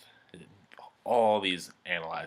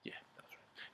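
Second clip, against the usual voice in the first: whispered aside; 20 dB quieter than usual. Real, not acted. Muttered aside to himself.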